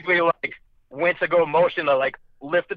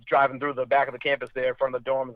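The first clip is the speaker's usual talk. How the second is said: ordinary delivery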